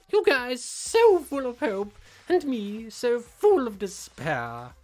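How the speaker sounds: loud, slow, drawn-out gloating; theatrical villain. Acted. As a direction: savour each word like a showman. Villain contrasts hope and despair.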